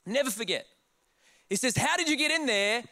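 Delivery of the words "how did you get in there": higher, insistent imitation; mock upset demand. Old Italian guy to intruders, mock indignant. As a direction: re-enact an indignant challenge. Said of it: "He's getting upset".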